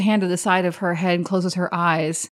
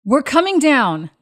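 calling down; 6 semitones higher, 5 dB louder than usual. Acted. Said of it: "She calls down to Northry".

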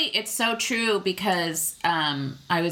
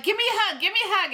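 imitating an eager husband, much higher-pitched; playful pestering; 14 semitones higher, much faster than usual. Wife mimics husband chasing her around the house, playful pestering. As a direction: pester playfully in a high mock voice.

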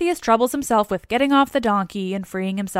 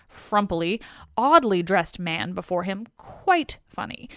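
usual speaking voice

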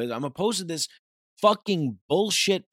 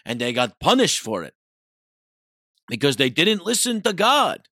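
mocking sermon; 6 semitones higher, 4 dB louder than usual. Mocking the priest scolding the child, preachy and loud. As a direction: lecture like a smug priest. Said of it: "the priest is like".

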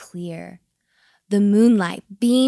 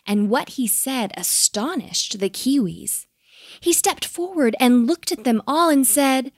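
usual speaking voice

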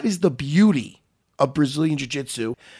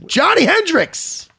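slow, higher, louder name call; announcer call; 12 semitones higher, 5 dB louder, much slower than usual. Acted. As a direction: announce the guest like a ring MC. Announcing the guest.

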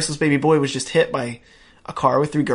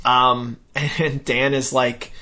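normal talking voice

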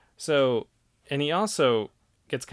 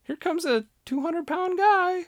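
high, loud, excited; 5 dB louder than usual. Real, not acted. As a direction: ramp up the drama. Excited retelling.

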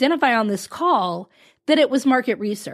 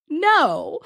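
a blunt no broken by laughter; mock flat no through a laugh; 8 semitones higher than usual. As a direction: laugh the refusal out. Client's flat refusal, retold laughing.

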